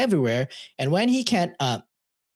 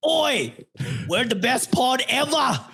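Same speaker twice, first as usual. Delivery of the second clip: hammed-up Aussie shout of Oi, pitched up high; Australian accent; 9 semitones higher than usual. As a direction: play a jokey Australian bloke bragging. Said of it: "Now do your bit".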